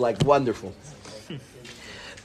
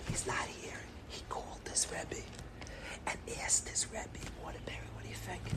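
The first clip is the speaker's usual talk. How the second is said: secretive whisper, speeding up at the end; 22 dB quieter than usual. Retold or imitated. Mother whispering what the Rebbe was asked. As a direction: whisper the gossip under your breath. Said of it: "She said".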